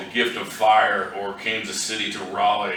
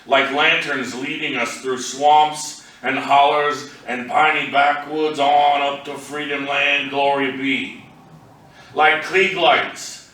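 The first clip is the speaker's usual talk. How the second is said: raised, projected poem recital; poetry reading; 5 semitones higher, 4 dB louder than usual. Acted. Poet reads a poem to an audience, projected recital voice. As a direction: read it out higher and louder than conversation, phrase by phrase.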